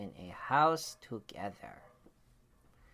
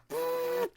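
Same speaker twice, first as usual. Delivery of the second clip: vacuum sound. Acted. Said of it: "the vacuum cleaner said".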